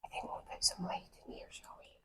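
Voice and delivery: soft hushed whisper. Real, not acted. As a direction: whisper so as not to disturb. Inside dark quiet crypt.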